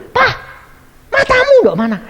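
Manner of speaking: high, loud mock snap; a hammed-up wife snapping at her husband; mock scolding. Wife scolding her husband who tripped, mock snap.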